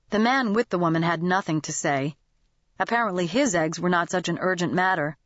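usual speaking voice

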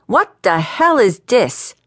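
a man with smoke coming out of his ears; fuming. A narrator's reading. Valentino confronts the young hotshot in his office, fuming. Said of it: "Smoke was starting to come out".

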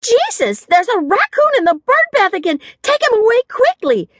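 high-pitched, urgent panic; alarmed yell. A narrator's reading. Brandy ordering a raccoon removed, in overblown alarm. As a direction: cry out as if an avalanche were coming. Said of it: "in a tone more suited to an impending avalanche".

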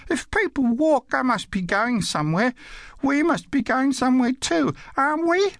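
put-on very high child's voice, pressing her point; insistent little girl. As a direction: reason with Dad in a high, persistent kid voice.

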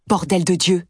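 a sudden frightened outburst at a knock on the window; startled cry. A narrator's reading. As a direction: jolt out the oath in fright. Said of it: "je crie effrayé".

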